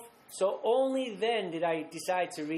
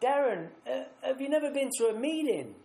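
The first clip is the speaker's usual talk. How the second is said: put-on higher, gentle voice of a kind older lady on the phone; the lovely older lady who answered the phone, voiced a little higher; 5 semitones higher than usual. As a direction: lift the voice up for the sweet phone lady. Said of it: "And she said".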